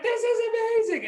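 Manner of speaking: high, bright delighted exclaim. Acted. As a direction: marvel at the feast. Amazed at feast.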